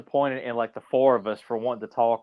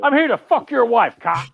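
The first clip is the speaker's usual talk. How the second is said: high, loud mock taunt; mock defiance; 10 semitones higher, 6 dB louder than usual. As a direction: taunt a cop in jest, pitched up. Acting out defiant youth to police.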